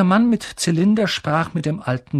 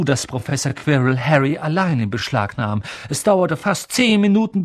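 plain reading voice

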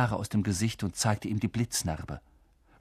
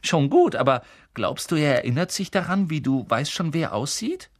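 put-on eager twin boy; 5 semitones higher, 4 dB louder than usual. Twin asking his mother.

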